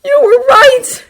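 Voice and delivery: a high, loud, slow sobbing cry. Acted. Izuku to the villain, sobbing in defeat. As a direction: wail it, betrayed and helpless. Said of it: "Izuku sobs".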